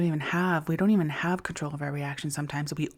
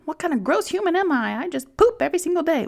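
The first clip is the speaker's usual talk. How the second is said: much higher, hammed-up horrified whine; mock self-disgust; 12 semitones higher, 5 dB louder, faster than usual. Joking to viewers about berating ourselves, hammed-up high whine. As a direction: play a silly over-the-top self-shamer.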